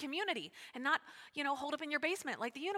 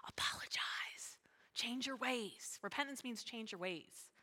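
slow, soft, near-whispered instruction; hushed urging; 8 dB quieter, slower than usual. Real, not acted. Pastor urging congregation to repent, hushed.

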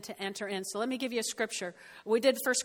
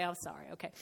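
grudging apology; 7 semitones lower, 12 dB quieter, faster than usual. Retold or imitated. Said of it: "And I say".

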